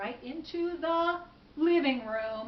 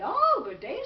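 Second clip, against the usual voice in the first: lightly voiced high, louder hello; cheery greeting; 7 semitones higher, 8 dB louder than usual. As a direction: greet a visitor in a raised storyteller voice. Old woman greets the man.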